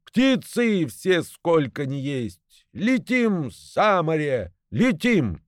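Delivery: slow, high proclaiming call; grand high rallying cry; gander's cry. A narrator's reading. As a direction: rouse all birds to fly. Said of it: "крикнул".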